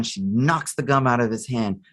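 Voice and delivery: usual speaking voice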